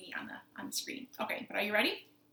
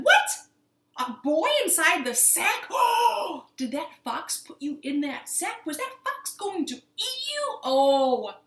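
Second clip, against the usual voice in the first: loud, high gasping outrage; big storybook shock with gasps; shocked woman; 6 semitones higher, 9 dB louder than usual. Acted. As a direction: exclaim in theatrical horror. Woman shocked to find boy.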